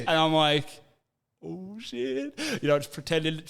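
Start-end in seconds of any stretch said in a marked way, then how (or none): 1.4-2.4 caught out. Retold or imitated. Caught out by the boys.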